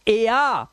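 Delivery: "ए आ", two vowels run together; ARE vowel sound. Shown as a demonstration. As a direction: say "ए आ" as "ay" gliding into "aa". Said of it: "ए का उच्चारण ए आ हो जाएगा".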